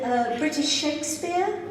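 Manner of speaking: usual speaking voice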